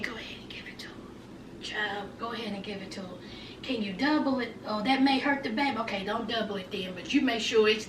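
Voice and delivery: imitating hushed side talk, very soft and low; background murmur. Mimicking medical staff in the background about anesthesia, hushed murmur. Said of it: "they were in the background saying".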